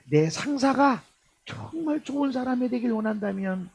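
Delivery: ordinary talk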